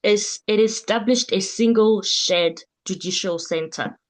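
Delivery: slow, carefully spelled-out words; over enunciating. Shown as a demonstration. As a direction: say each word slowly for the interpreter. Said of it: "let me say it again slowly".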